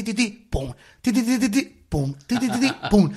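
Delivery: ti ti pum, tapped out like bumps in a road; vocal bump rhythm. Shown as a demonstration. Podcast host acting out the rhythm of road bumps, playful. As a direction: beat out the bumps with your voice. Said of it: "para que sea ti".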